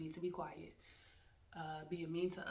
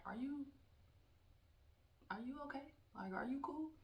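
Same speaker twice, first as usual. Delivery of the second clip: a lightly raised, mock-worried are you okay; mock concern; 8 semitones higher, 3 dB quieter than usual. Teacher teasing a cursing student, mock concern. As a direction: pretend to check if they're all right, teasing.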